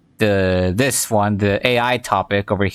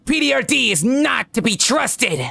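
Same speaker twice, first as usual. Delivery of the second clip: high-pitched paranoid character voice; hammed-up cartoonish high voice; crazy conspiracy nut; 12 semitones higher than usual. Shown as a demonstration. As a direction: declare it like a frantic crackpot. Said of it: "so I give them a voice like this".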